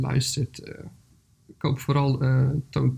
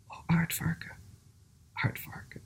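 zacht, stemloos fluisteren; gefluister; 3 semitones higher, 10 dB quieter than usual. A narrator's reading. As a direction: fluister het als een stem in gedachten, met een pauze. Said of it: "hoorde in gedachten iedereen fluisteren". (fluisterend voorgelezen)

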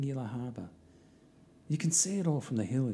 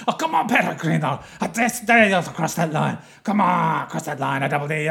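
high, loud snarling dare; ferociously barking dog; 5 semitones higher, 11 dB louder than usual. Acted. Dog daring the pilgrim to cross the line, ferocious barking. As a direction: shout a dog's challenge at full force. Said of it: "a dog started barking ferociously".

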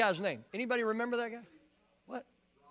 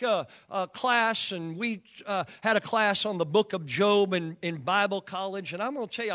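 usual speaking voice

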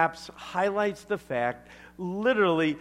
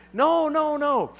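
ordinary talk

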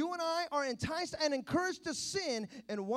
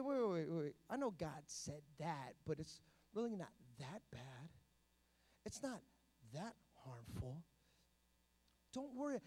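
sly tempter devil; 9 semitones lower, 10 dB quieter than usual. Acted. The enemy talking Eve round, soft low coaxing. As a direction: quietly reassure as the enemy would. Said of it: "Even the enemy looks and says".

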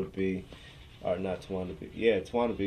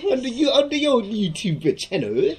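put-on horrible British accent; 12 semitones higher, 7 dB louder than usual. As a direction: stretch out a squeaky, badly faked British accent. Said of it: "that was a horrible accent as well".

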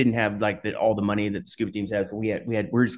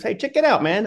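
excited call; 10 semitones higher, 4 dB louder than usual. Retold or imitated. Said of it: "my buddy goes".

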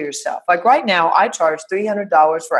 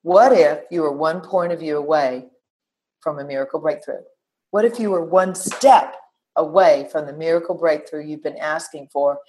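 ordinary delivery

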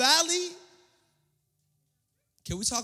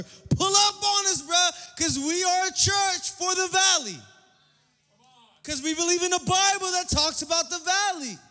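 preaching shout; 10 semitones higher, 9 dB louder than usual. Real, not acted. Preacher's climactic call.

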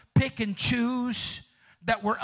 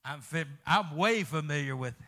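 usual speaking voice

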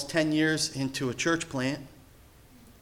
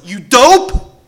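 much louder and higher, fast, mock scolding; teasing rebuke hammed up for the congregation; preacher's hammed-up father scolding his son; exasperated father; 11 semitones higher, 12 dB louder than usual. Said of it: "I'm gonna say".

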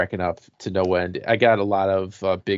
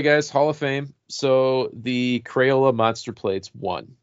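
usual speaking voice

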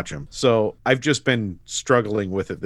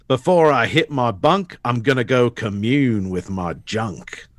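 lightly voiced smug character line; quoting a show line. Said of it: "He says".

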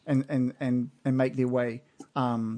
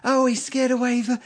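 much higher admiring punter voice; tavern patron; 10 semitones higher than usual. Acted. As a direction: gush like a happy drinker in the inn. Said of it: "hear people inside going".

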